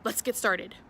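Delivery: bright, loud channel welcome over music; cheerful host voice opening the video; upbeat vlog intro. Acted. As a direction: greet your viewers like a YouTube host.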